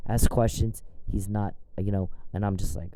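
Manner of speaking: ordinary talk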